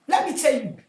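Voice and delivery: ordinary talk, nothing marked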